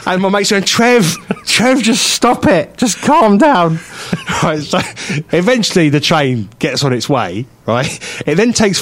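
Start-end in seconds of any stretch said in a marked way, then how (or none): 0.6-3.8 higher, slow exasperated plea; pleading mate. Retold or imitated. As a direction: mimic a friend begging him to stop. Mate pleads with ranting speaker.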